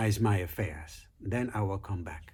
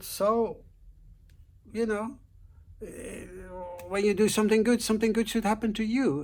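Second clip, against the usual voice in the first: a hint of a pleading wife, pitched higher; lightly voiced wife reasoning with the snake, higher; 10 semitones higher than usual.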